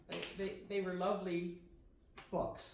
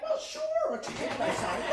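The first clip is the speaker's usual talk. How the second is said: bewildered; 6 dB louder than usual. Retold or imitated. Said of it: "I'm like".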